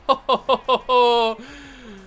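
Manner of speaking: string of loud, high "oh"s; loud staccato cries tailing off; excited gasps. Real, not acted. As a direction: burst out in rapid repeated oh-oh-oh. Reacts to end zone play.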